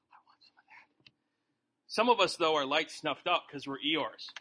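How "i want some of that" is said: hushed, envious whisper. Retold or imitated. Onlookers at the picnic whisper with envy. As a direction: whisper it like you're craving it. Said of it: "they're all like".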